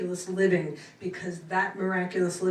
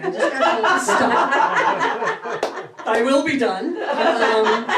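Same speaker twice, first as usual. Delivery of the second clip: high, loud laughing voice; 5 semitones higher, 8 dB louder, slower than usual. Real, not acted. Speaker laughs, told by her guides to stop, real laughter. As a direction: break into real laughter mid-sentence. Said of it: "I am actually hearing to stop talking".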